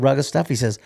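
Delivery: ordinary delivery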